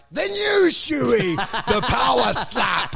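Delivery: drawn-out mock showman shout; hyped-up announcement. Showing the crowd a new stunt, hyped-up announcing. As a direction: announce it slowly like a grand reveal.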